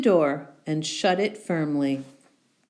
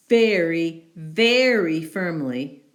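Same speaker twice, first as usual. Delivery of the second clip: very punched louder and slower; emphatic very; 4 dB louder, slower than usual. A narrator's reading. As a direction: lean on each very. Emphatic narration.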